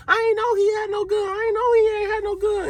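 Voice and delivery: very high, whiny imitation of her; mocking the woman's high-pitched denial; mock girl voice. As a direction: squeak out her excuse in a mocking falsetto. Mimicking the woman's protest.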